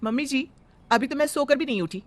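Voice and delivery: usual speaking voice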